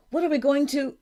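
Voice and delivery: high, loud, urgent question; alarmed. A narrator's reading. Girl to her friend at the river, alarmed. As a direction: start an urgent question, break off.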